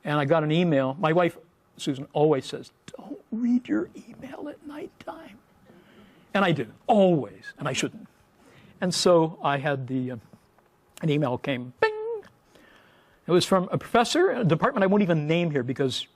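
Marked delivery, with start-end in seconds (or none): 3.0-5.4 soft whispered warning. Retold or imitated. Wife's advice quoted. 11.8-12.2 high ping like a notification; high bing chime. Retold or imitated. Email notification sound.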